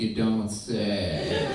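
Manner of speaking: very slow, sing-song sarcasm; sarcastic. Retold or imitated. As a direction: drag it out with dry irony. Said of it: "I'm like".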